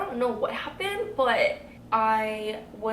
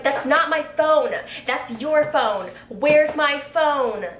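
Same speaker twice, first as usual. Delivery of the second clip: loud, slow, emphatic demand; exasperated yelling, dragged out; retold anger; 5 semitones higher, 7 dB louder, slower than usual. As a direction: hammer each word in mock outrage. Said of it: "I was so mad about it".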